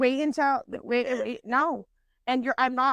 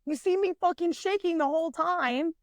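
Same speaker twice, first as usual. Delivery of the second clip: high-pitched, frantic protest in a retelling; retold panicked complaint; 7 semitones higher than usual. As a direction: replay your nervous griping, pitched up.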